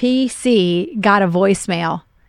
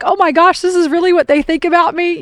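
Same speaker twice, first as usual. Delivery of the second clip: higher-pitched, mildly upset retelling; retold crying; 6 semitones higher than usual. Recalling the hurt of an overheard voicemail, imitated tearful dismay. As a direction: recall a hurt wail, without real sobs. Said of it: "I'm, like, crying".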